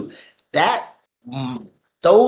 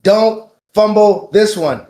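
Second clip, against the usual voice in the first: slow, louder, higher-pitched plea; emphatic redo; 3 semitones higher, 5 dB louder, slower than usual. Shown as a demonstration. Host restating his warning to the company, slow emphatic shout. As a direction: punch out each word, slowly and loudly. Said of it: "let's do it like this".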